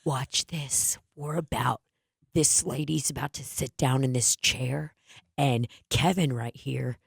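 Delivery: low, quiet, conspiratorial narration to camera; hushed setup with a suppressed snicker; fake prank video. Prankster to camera before the chair trick, hushed fake prank video. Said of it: "like those fake prank things".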